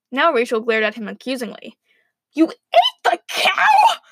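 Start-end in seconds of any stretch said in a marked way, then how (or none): 2.3-4.0 very high, drawn-out outraged screech. A narrator's reading. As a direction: screech the accusation at top pitch. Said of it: "she screeched".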